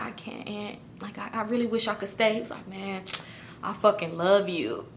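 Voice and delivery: very soft, tender retelling; quiet, understated lovers' exchange; hushed parting. Retold or imitated. Retold reluctant, tender parting.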